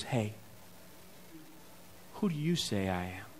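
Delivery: soft, low-voiced lean-in question; hushed aside. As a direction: murmur it as if leaning to a neighbor in the pew. Jesus leans over during sermon.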